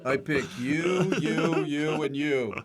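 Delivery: slow, deliberate picking of victims; single them out one by one, slowly; mock cocky challenge. Host playing the comedian sizing up an easy crowd, mock cocky.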